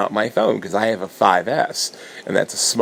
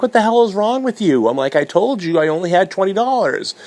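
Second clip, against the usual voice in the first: much higher, louder exasperated yelling; outraged; 12 semitones higher, 4 dB louder than usual. Retold or imitated. Furious at the printer guy over his price, high outraged yell. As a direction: blow up at him in disbelief.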